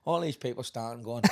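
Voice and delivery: ordinary delivery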